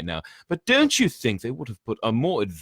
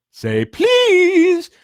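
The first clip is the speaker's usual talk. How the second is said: high, loud, sneering poltergeist taunt; high squeaky mischief-maker mocking first-years; 13 dB louder than usual. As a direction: tease them like a gleeful spirit. Peeves voiced in story.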